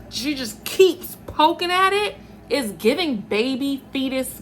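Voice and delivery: playful high voice. Real, not acted.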